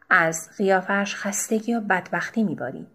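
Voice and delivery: usual speaking voice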